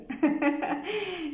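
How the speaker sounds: a short bright laugh; high laugh. Real, not acted. Laughing after applauding Rose, a short high laugh.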